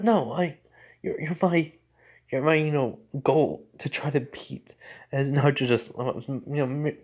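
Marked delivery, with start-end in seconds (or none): 0.0-4.7 flustered, higher-pitched protest; acted startled stammer; taken aback. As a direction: stumble over the words in surprise. Flustered sister reacting in story.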